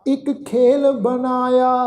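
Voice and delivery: slow, drawn-out sacred recitation; measured Gurbani reading, sustained line endings; scripture recitation. Shown as a demonstration. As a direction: chant each phrase slowly with long held vowels.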